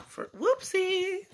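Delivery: ordinary delivery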